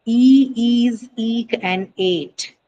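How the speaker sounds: "e, ees, eek, ate" with drawn-out vowels; long vowels. Shown as a demonstration. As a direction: slowly stretch each of "e, ees, eek, ate". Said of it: "because they carry long vowels and diphthongs".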